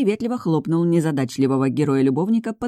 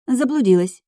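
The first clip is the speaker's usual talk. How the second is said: innocent; 7 semitones higher, 4 dB louder than usual. A narrator's reading. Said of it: "улыбнулась я самой невинной улыбкой".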